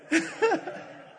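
high, loud burst of laughter. Real, not acted. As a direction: laugh out loud at your own joke. Laughs at own anecdote.

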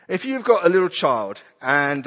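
ordinary talk

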